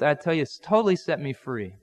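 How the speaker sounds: usual speaking voice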